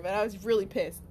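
normal talking voice

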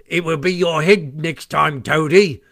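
mocking call. A narrator's reading. Stoats jeer down at Toad sinking in the water, mocking. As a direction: taunt him from the bridge. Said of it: "they called out to him".

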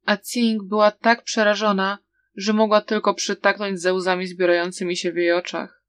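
ordinary delivery, nothing marked

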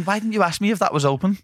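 high exasperated question; exasperated friend's voice. Retold or imitated. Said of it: "Dan was defo thinking".

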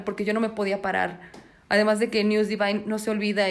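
ordinary talk

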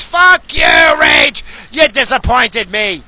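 loud outraged yell, pitch jumping; angry shout. Pretend. Disappointed fan yells at Rage Against the Machine, loud and angry. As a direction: shout it at the band like a betrayed fan. Yelling at the band.

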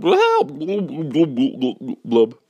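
high squealed help dissolving into blubs; cartoon gurgling underwater cry; drowning. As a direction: cry out, then burble as if going under. Calvin drowning in the bath.

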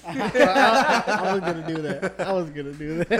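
laughing hard through the words; cracking up. Real, not acted. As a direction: burst out laughing, loud. Cracking up at the prank.

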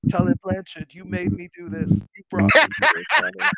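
high-pitched mock wrestler's lament; Dusty Rhodes. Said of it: "He's like".

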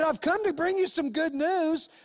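the angel brings the shepherds joyful news; joyful angel announcement. Acted. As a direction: announce the good news with real joy. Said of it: "He said".

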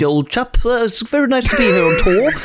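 hammed-up RP accent; mock posh British. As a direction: parody a snooty band thanking the crowd. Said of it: "sån jävla uppröv engelska".

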